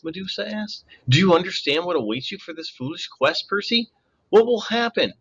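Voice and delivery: high, soothing purr. A narrator's reading. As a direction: lull him with a sweet voice. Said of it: "comfortable purr".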